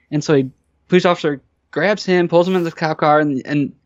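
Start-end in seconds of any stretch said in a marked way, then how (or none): none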